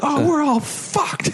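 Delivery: put-on high, slow panicky child voice; a kid seeing the army behind him, high and slow; scared kid. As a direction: squeak it out like a terrified kid. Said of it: "he's like".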